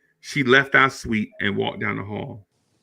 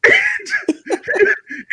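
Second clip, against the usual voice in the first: big laugh. Real, not acted. Laughing at own story.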